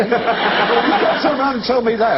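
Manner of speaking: slow, high, exaggerated indignation; mock arguing. Repeating the great song of arguments, mock indignation. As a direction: drag out the protest in a high voice. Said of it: "when people have arguments".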